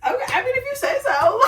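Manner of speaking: high-pitched, through laughter; amused, squeaky skepticism; laughing disbelief. Retold or imitated. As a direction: concede it while cracking up. Laughing disbelief at his vow.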